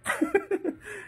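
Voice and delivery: a short high burst of laughter; amused laugh. Real, not acted. Laughing at the debate story, a short high amused laugh. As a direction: laugh at the absurd idea. Laughing at his own joke.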